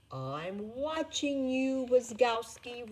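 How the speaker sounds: high, loud, slow stern warning; Roz, the paperwork boss, warning careless Mike. A narrator's reading. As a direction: warn him off, every word a threat. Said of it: "Roz warned sternly".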